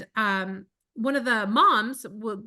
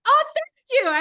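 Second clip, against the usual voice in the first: high bright thanks; 14 semitones higher, 6 dB louder, slower than usual. Retold or imitated. Replying while crossing street.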